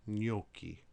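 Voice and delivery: gnocchi with gn like ny in onion, NYO-kee; Italian gn as ny; Italian gn sound. Shown as a demonstration. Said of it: "sounds like the N as in onion".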